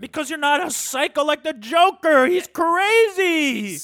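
loud, high outburst with a long drawn-out last word; exasperated shout. Real, not acted. Host argues the Joker scene, exasperated shouting. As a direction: raise your voice, stretch the final word. Heated argument over movie scene.

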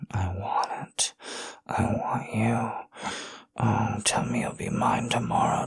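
soft, breathy whispered plea; intimate whisper. Acted. Lover pleads with partner to stay, intimate whisper. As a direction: whisper it with longing, barely voiced.

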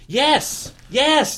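high loud triumphant yes; excited shout. Real, not acted. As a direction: cheer it out. Excited confirmation.

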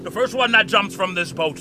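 ordinary talk

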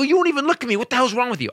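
high, loud indignant rant; going off angrily. Retold or imitated. Comic going off on a woman who ignores him, loud angry rant. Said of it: "see me snap on this woman and go off".

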